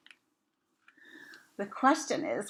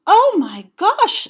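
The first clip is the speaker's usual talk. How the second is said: high, loud cry of shock at the house; shocked gasp; 12 semitones higher, 11 dB louder, slower than usual. Acted. Amelia Bedelia sees the wrecked house, high shocked cry. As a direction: throw it up high in alarm.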